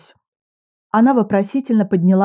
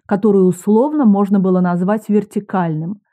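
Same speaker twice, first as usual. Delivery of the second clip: ordinary delivery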